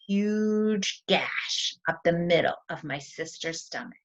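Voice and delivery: ordinary delivery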